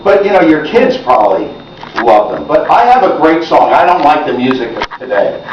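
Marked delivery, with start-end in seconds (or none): none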